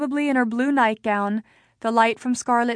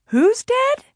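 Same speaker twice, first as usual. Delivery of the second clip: confused mother; 13 semitones higher, 5 dB louder than usual. A narrator's reading.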